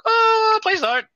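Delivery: high, loud whiny begging; hammed-up squealed plea; mock pleading. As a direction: beg in an exaggerated high wail. Acting out pleading with deputy.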